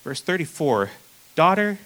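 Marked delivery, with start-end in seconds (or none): 1.3-1.8 tender blessing. Retold or imitated. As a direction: speak it gently like a blessing. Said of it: "He said to her".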